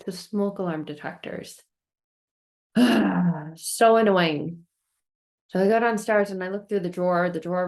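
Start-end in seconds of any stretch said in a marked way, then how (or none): 2.7-3.5 loud, heavy sigh; exasperated sigh. Retold or imitated. Homeowner reacting to the smoke alarm, exasperated sigh. 3.6-4.7 slow, loud exasperation; annoyed. Retold or imitated. Homeowner fed up with a beeping smoke alarm, annoyed.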